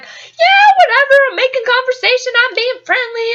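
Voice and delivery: high, loud, blithe rush; acted-out oblivious small talk; breezy chattiness. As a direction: chirp along without thinking. Her careless chatty inner voice.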